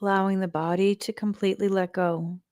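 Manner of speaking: slow, even meditation voice with long pauses; guided meditation. Acted. Teacher guiding listeners to relax the body and breathe, calm guided meditation. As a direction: lead them calmly through the breath, drawing out phrase ends.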